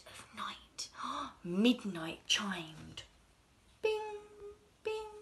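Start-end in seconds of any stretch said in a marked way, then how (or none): none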